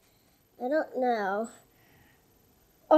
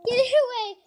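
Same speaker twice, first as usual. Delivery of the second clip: higher, louder panicked plea; phobic panic; 10 semitones higher, 7 dB louder, faster than usual. Real, not acted. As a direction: push it away, frightened.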